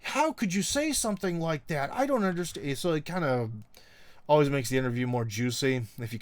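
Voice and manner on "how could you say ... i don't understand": high-pitched scandalised "what"; hammed-up disbelief, voice pitched up; mock shock. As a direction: imitate an affronted, bewildered reaction. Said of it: "gets them in a weird sort of mood. Their reaction is like".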